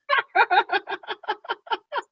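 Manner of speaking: high staccato cackle; real laughing fit. Real, not acted. Co-host hearing the accent rant, high cackling laugh. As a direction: let out a long, high run of ha-ha-ha.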